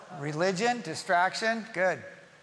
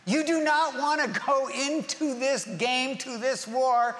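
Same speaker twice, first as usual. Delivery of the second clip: urgent warning; 6 semitones higher than usual. Retold or imitated. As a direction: warn them hard, almost shouting. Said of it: "he goes".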